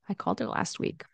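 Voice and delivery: whispered "called her" linked. Shown as a demonstration. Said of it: "connected speech".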